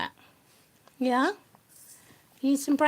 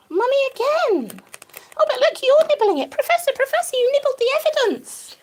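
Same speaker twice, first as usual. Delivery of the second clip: higher, louder amused exclamation; delighted surprise; 8 semitones higher, 4 dB louder than usual. Real, not acted. Owner catches the rabbit eating the clue, delighted. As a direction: catch the bunny in the act, delighted.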